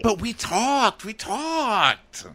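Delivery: high, slow, whiny imitation of the man; playing Blake. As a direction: plead feebly, stretching each word. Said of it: "you be Blake right now".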